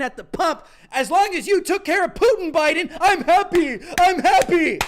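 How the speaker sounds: loud, high mock celebration; sarcastic cheering. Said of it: "my monotone sarcasm".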